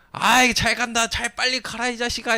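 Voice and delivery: high, loud taunting jeer; mocking jeer. Heckler jeering at the fleeing pastor, high mocking taunt. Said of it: "이러면서 막 돌을 던져".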